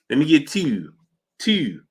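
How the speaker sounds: Baltimore "two" gliding like "tew", pitch swooping down; Baltimore accent. Retold or imitated. Streamer mimicking Baltimore locals ordering, in a Baltimore accent. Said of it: "in Baltimore".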